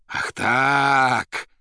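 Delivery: loud, high, cornered outburst; wild, hunted cry. A narrator's reading. Cornered poet faces the orderlies, wild cry. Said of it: "дико и затравленно озираясь, произнёс Иван".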